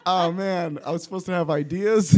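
sheepish confession broken by laughter; laughing. Retold or imitated. To her writing partner, a laughing confession. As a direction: laugh through the admission of having no ideas. Admitting she came without ideas.

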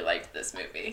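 low hushed whisper. Real, not acted. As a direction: drop to a whisper. Whispered aside.

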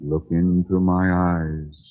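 slow, deliberate hypnotist voice, playfully put on; mock hypnotist. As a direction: draw her in like a stage hypnotist. [name] mock-hypnotizing Mary.

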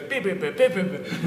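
high, staccato vocalised beeps; imitated riff beeps. Shown as a demonstration. Bandmate pitching a riff over a voice chat, imitated beeps. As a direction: beep out a guitar riff idea. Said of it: "there's a bit that goes".

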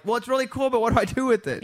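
high, loud, politely bewildered; baffled recipient. Acted. Host voices someone given a dog, baffled and high-pitched. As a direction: puzzle aloud over an unwanted present.

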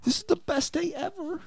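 high delighted squeak; child voice. Pretend. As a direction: gush like a happy kid. Voicing the kids.

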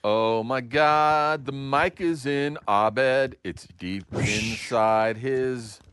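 slow dramatic narration. Acted.

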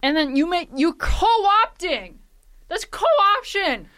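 high, slow mock outrage; shrill overlapping reality fight. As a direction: rant like an offended fan. Mock outraged rant, both high.

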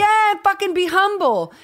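high, emphatic rebuke; exasperated self-scolding. Retold or imitated. Telling her younger self to accept praise, exasperated and high.

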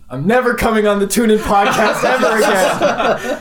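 high, loud laughing mock pout; hammed-up sulky exit line; mock huffy quote. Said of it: "the last thing you said was".

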